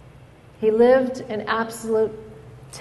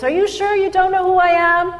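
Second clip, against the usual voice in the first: demanding, much higher and louder; 9 semitones higher, 4 dB louder than usual. A narrator's reading. Student insisting to the professor, louder and higher. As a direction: push the question up like an indignant student. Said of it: "the student says in a louder voice".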